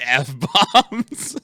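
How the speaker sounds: laughing through the word; giggling as it slips out. Real, not acted. Host laughing while telling co-host about swearing.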